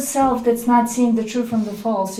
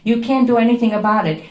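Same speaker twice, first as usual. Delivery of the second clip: ordinary delivery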